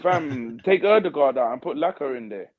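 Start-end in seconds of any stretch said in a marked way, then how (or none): none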